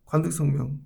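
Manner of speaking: ordinary delivery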